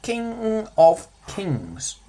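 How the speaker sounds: King of Kings, drawn out slowly, each word weighted; slow stressed title. Shown as a demonstration. Teacher savours the title King of Kings very slowly. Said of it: "next bit".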